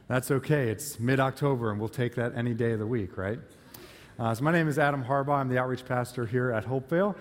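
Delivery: ordinary delivery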